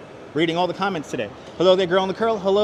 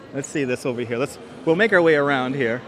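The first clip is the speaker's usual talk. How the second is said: ordinary delivery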